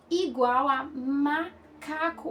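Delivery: slow "macaco" with "ma" held apart; stress first sound. Shown as a demonstration. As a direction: hold the "ma" of "macaco", then finish the word. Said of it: "perceber o som inicial".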